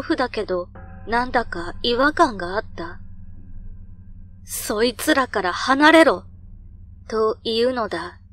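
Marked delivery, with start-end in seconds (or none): 4.4-6.2 loud, urgent command; sharp warning shout. Retold or imitated. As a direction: bark it out as a warning. Grandfather warns him off.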